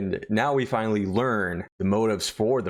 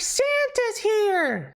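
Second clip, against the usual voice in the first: put-on much higher, slow, sing-song little girl; much slower than usual. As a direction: draw it out like an excited small child. Little girl announcing Santa.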